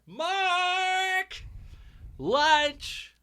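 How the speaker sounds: put-on high, drawn-out holler for a child; boisterous mum. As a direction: imitate a mother calling out of the window. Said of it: "was your mother had that big boisterous voice".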